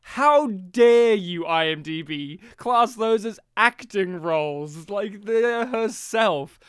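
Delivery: mock-outraged, drawn-out and loud; indignant. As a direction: scold with stretched-out, theatrical indignation. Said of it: "And I said".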